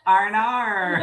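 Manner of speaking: high Australian "R and R" as a comic "oh no"; mock dismay in an Australian "R and R"; Australian accent. Imitating a friend's reaction, "R and R" in Australian accent. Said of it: "Shayla's over here like".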